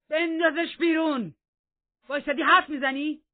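high, very loud furious yell; savage shout. A narrator's reading. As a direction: bellow the order wildly, pitched high. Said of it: "وحشیانه فریاد زد و گفت".

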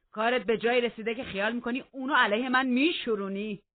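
loud anger building to high accusation; roaring threat. A narrator's reading. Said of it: "هیتکلیف قرید".

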